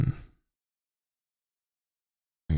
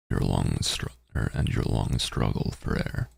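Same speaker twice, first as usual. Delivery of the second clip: narrating a text adventure; 3 semitones lower than usual. Acted. Reads text adventure aloud.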